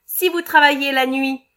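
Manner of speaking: mean boss finishing his jab; nasty retort. Acted. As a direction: press the cruel point home. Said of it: "répliqua méchamment le patron".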